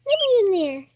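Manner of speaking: high, fast squeaky little-bird plea; high squeaky baby bird voice. Pretend. Vlogger voicing a chick wanting into the huddle, high squeaky plea. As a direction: squeak it like a tiny chick begging. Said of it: "He said".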